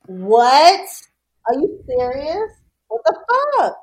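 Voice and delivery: loud, stretched WHAT, then high incredulous questions; acting out shocked disbelief; retold disbelief. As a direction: replay your stunned reaction, drawing it out. Retelling shocked reaction to death news.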